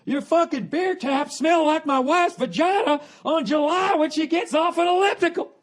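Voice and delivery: high, loud, slow ranting host; bar fixer yelling. Retold or imitated. TV bar fixer storming in, shrill slow loud rant. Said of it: "He comes in".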